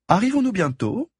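lofty high society lady voice; haughty aristocratic french. Acted. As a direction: play it as a snooty aristocrat, pitched high. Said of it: "en prenant une voix d'aristocrate".

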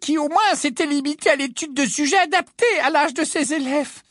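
high, loud put-on teacher's voice; high-pitched character voice of a schoolteacher. A narrator's reading. Umbridge speaks in high voice.

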